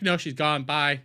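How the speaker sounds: ordinary delivery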